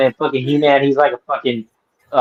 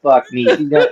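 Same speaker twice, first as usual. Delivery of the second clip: usual speaking voice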